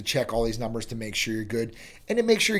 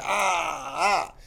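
pained groan; 4 semitones higher than usual. Pretend. Joints cracking and popping.

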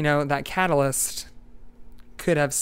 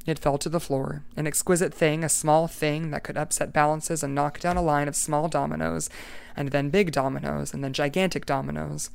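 ordinary delivery, nothing marked

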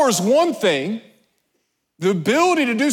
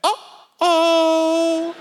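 high, drawn-out singsong oops; mock uh-oh; 10 semitones higher than usual. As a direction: mimic someone caught off guard, pitched way up.

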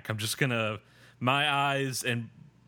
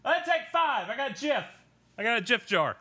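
high-pitched whiny plea; put-on high, pleading voice; pleading mock quote; 11 semitones higher than usual. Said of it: "being like".

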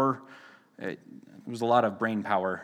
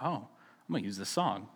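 quiet aside to himself; hushed inner voice; 4 semitones lower, 10 dB quieter, faster than usual. Retold or imitated. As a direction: pastor recalling deciding on the song, murmured under his breath. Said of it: "and I was like".

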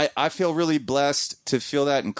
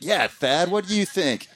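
retold eager prompt, higher pitched; imitated excitement; 6 semitones higher than usual. Host eagerly prompting Thad about a joke question, mock excited. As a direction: play an excited host egging someone on. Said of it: "Brett's all excited".

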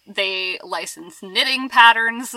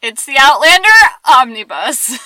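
excited announcement; 3 semitones higher, 7 dB louder than usual. Acted. Announcing the show title.

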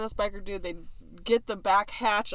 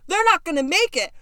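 higher and louder, alarmed; alarmed cry; 9 semitones higher, 7 dB louder than usual. Retold or imitated. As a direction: call it out in alarm. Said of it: "I'm going".

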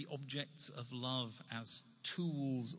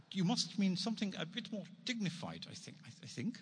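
put-on terrible Dutch accent; 8 semitones higher than usual. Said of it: "I'm going to do a really terrible Dutch accent".

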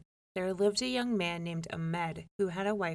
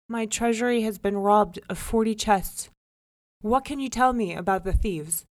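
louder royal demand; stern king; 3 semitones higher, 8 dB louder than usual. A narrator's reading. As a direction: press him firmly. King questions.

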